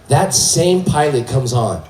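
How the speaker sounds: ordinary talk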